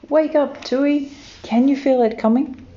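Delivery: high, loud, urgent calling voice; eager wake-up call. A narrator's reading. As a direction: rouse a sleeping friend, high and eager. Kiwi calling friends awake.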